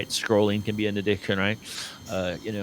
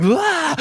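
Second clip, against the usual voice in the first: mock panicked yelp; 6 dB louder than usual. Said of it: "and you're like".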